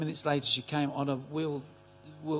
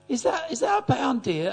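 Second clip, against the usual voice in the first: put-on old lady voice; 11 semitones higher, 6 dB louder than usual. Blind old lady checks coin.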